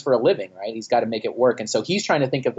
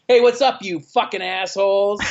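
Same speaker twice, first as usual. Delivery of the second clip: put-on drunk rowdy greeting; 7 semitones higher than usual. Tipsy singer yelling a rude hello at the crowd. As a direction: bellow it with a few drinks in you. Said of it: "a couple shots of whiskey ... it's like".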